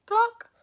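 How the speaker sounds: a very high, loud clucking hen; shrieking farmyard cluck. Acted. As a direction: squawk it like a hen. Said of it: "And the hen said".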